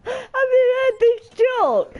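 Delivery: high, squeaky words through tears of laughter; can barely get it out for laughing; crying with laughter. Real, not acted. Helpless laughter at partner joke.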